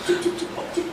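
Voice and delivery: whispered, very soft shushing; urgent hush. Acted. Madam hushing the maid so nobody hears, whispered hush. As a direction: hush them under your breath.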